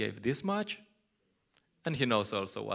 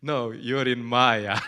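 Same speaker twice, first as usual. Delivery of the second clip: slow, louder preachy rebuke; old-school scolding; 11 dB louder, slower than usual. Retold or imitated. Early devotee scolding a fellow devotee, slow and stern. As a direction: lay down the accusation slowly, like the early devotees. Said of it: "they would speak like this".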